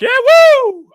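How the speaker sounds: hammed-up victory shout; triumphant whoop. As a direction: cheer like you just survived the flight. Celebrating after landing.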